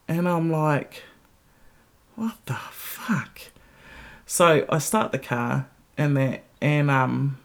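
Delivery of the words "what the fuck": slow breathy whispered disbelief; incredulous whisper. Retold or imitated. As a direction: mutter it under your breath in disbelief. Annoyed at the honking car.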